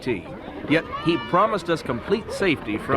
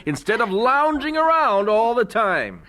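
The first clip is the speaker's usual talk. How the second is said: mock scolding; 8 semitones higher, 3 dB louder than usual. Father jokes about his sleeping baby, mock scolding. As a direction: pretend outrage at a lazy baby.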